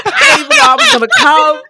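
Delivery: frantic rush. Retold or imitated. Telling a fan about the failed road test, frantic and fast. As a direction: let it all pour out at once.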